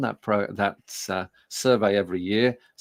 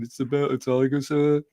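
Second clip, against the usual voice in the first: higher-pitched Brummie schoolboy answer; Birmingham accent; 5 semitones higher than usual. Retold or imitated. Pupil answering the English teacher's question about the poem, Birmingham accent. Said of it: "cretin with a Birmingham accent".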